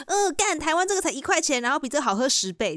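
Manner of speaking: high, scoffing boast that starts well up and settles; mock brag. Scoffing at the American bubble tea to friends, a high mock brag. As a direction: show off that home is better, teasing.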